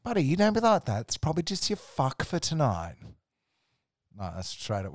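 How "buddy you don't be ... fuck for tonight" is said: high, loud put-on camp voice; mock cajoling client. Client teases roofer about his caller, imitated camp cajoling. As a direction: tease it pitched way up, hammed-up.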